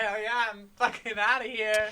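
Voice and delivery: high, loud, cheeky voice for the teacher; gleeful teacher. Retold or imitated. Teacher accepting Aslan's invite, loud gleeful yell. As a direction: blurt it like she's bolting for the door. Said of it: "She's like".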